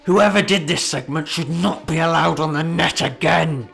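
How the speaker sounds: loud, slow, indignant put-on voice of an angry commenter; hammed-up, grumbling outrage over a TV joke; outraged Facebook commenter. Reading out an angry viewer's comment, loud mock outrage.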